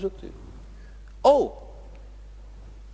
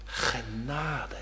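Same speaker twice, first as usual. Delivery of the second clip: soft hushed whisper, much lower; whispered word of grace; 9 semitones lower, 13 dB quieter than usual. Acted. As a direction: breathe it gently into the ear. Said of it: "dan fluistert de Heere Jezus in mijn oor".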